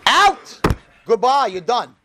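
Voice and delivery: shouted high, loud outburst; mock furious expulsion, high and loud; angry dismissal. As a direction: throw him out with a sudden yell. Yeshiva throws the boy out.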